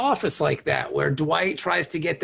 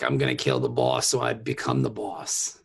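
lightly voiced Klingon, much lower; a hint of Klingon, pitched way down. Co-host mimics an ambitious Klingon underling, lightly voiced and low. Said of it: "It's very Klingon of them".

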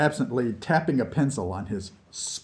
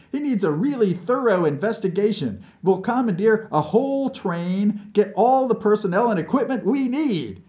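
higher, louder, gleefully sweeping plan; amused enthusiasm; 11 semitones higher, 5 dB louder than usual. Acted. Vlad laying out the Siberia expedition, amused and eager. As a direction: build it up with high, eager energy.

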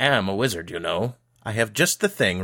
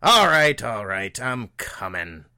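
irritated shout; 3 semitones higher, slower than usual. A narrator's reading. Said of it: "an irritated voice shouted from back inside".

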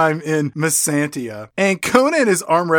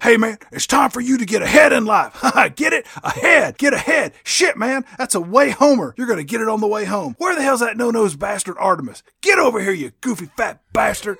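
hammed-up bro hero; 6 semitones higher, faster than usual. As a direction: bark out cheesy one-liners. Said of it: "He walks in, he's like".

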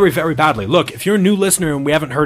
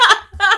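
high burst of laughter. Real, not acted. Laughing after joke.

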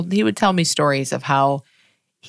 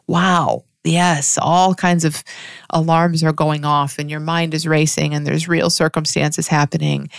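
ordinary delivery

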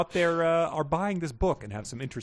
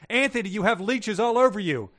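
higher, urgent yell; 10 semitones higher, 5 dB louder than usual. Acted. Elizabeth warns Anthony about leeches, urgent yell. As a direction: call out in alarm. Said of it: "Elizabeth yelled".

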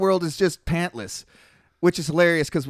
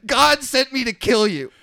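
loud, high mock-holy shout; angel shouting; 7 semitones higher, 4 dB louder than usual. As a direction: bellow the divine order like a zealous killer angel. Angel shouting his mission.

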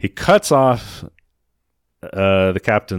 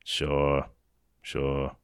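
softer, lower muttered sure; muttering; 8 semitones lower, 10 dB quieter than usual. Retold or imitated. Retelling the captain muttering to Hector, low mutter. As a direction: mumble it darkly. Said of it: "Captain's still muttering".

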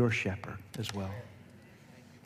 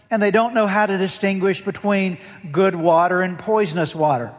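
louder, higher pulpit voice driving every phrase home; full-force preaching; 6 semitones higher, 9 dB louder than usual. Acted. Pastor warning the congregation about sheep, preaching hard and loud. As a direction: preach it out to the back row.

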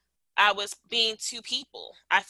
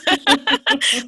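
fond laugh; 8 semitones higher, 8 dB louder than usual. Real, not acted. Fond memory of best friend.

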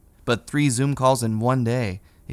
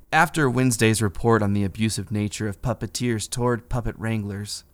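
usual speaking voice

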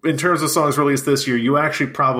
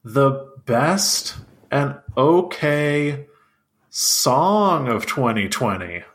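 slow announcer; much slower than usual. Acted. Echoing announcement very slowly.

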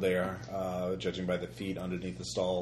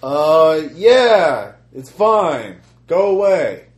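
shouting kidnapper; 8 semitones higher, 15 dB louder, much slower than usual. Acted.

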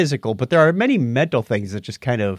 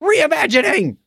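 mock outrage; 10 semitones higher than usual. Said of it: "Mike Robinson says".

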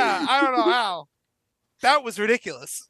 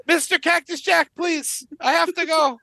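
higher-pitched mock pleading; acted polite urgency, pitched up; desperate passenger; 5 semitones higher than usual. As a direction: beg at a bathroom door. Acting passenger begging for the bathroom.

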